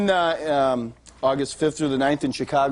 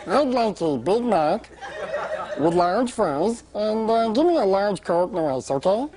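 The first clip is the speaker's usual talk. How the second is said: helium voice; 2 semitones higher, slower than usual. Retold or imitated. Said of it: "with a straight face, I look at her, I go".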